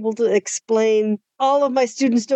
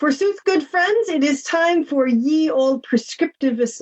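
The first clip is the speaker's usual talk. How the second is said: hammy stage actor; 4 semitones higher, slower than usual. Acted. Mock old-timey intro.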